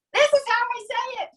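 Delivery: high-pitched, imitating an excited caller; mock phone-call announcement. Guest imagining the host calling her later, mock-triumphant. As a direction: play up the triumphant discovery. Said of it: "and you're gonna say".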